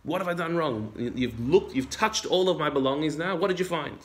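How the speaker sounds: high-pitched indignant protest, acted out; mock outrage at being searched; furious Yaakov. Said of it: "Yaakov is furious and says".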